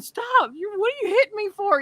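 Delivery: high, fast, whiny protest; imitating husband. Husband protesting as she hits him, high whiny mock voice. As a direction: squeal it like a man dodging blows.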